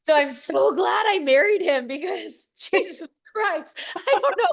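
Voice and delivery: real tears, pitch high and breaking, laughing through it; laugh-crying. Real, not acted. Telling Ashley she couldn't have lived without her husband, tearful and laughing.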